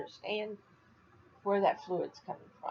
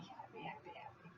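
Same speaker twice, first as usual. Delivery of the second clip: happy fluisterend herhaald, steeds zachter; fluisterend geluk; 25 dB quieter than usual. Real, not acted. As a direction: adem het woord bijna uit. Whispered repeat after happy outburst.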